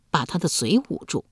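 ordinary delivery, nothing marked

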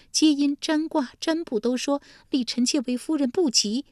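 high, earnest consort telling her dream; high concubine recounting a vision; court consort. Acted. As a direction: tell the dream high and pleading.

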